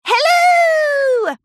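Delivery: high bright shouted hello; chirpy hello. Acted. Pinkie popping through a cloud, high chirpy shout. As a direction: call out cheerfully from the clouds. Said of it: "she chirped".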